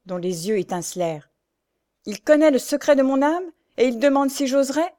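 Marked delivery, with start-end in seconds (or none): 2.1-4.9 higher, louder outraged disbelief; impassioned exclamation. Acted. Pierre stung by his brother's doubt, impassioned. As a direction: cry out at being doubted. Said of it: "s'écria le rémouleur".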